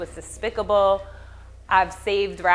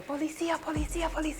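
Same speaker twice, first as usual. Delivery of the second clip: high, hushed "Policia" cries; mimicking a panicked voice; 9 semitones higher, 9 dB quieter than usual. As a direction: echo the family's frantic calls of "Policia". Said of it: "they were saying".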